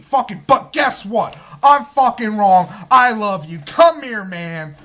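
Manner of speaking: mock angry tirade, high and loud, turning to gushing; hammed-up heroic rant with a loud change of heart; Oakenshield. Mocks Oakenshield's corny angry-then-loving speech.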